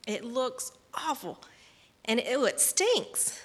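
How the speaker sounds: drawn-out, acted revulsion; disgusted. Mother complaining about a smelly doll, disgusted. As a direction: stretch the words slowly in mock disgust. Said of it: "it was horrible".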